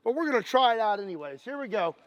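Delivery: normal talking voice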